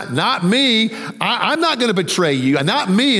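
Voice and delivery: acting out an insistent denial; mild protest. Peter assuring Jesus he won't betray him, mildly acted protest.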